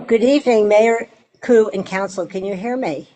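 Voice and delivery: ordinary talk, nothing marked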